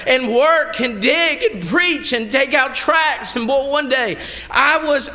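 high-pitched, driving testimony; impassioned preaching. Real, not acted. Preacher testifying to the congregation, impassioned and high. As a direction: preach it out with rising force.

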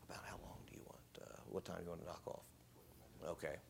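very soft, half-whispered question; hushed aside. Real, not acted. Visiting speaker asks the preacher about stopping time, hushed aside. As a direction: drop right down to a private murmur.

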